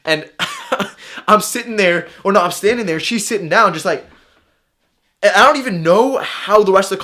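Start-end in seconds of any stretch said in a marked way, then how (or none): 0.4-1.2 high-pitched burst of laughter; sudden real laugh. Real, not acted. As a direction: laugh at how absurd it was. Laughing at the absurd outcome.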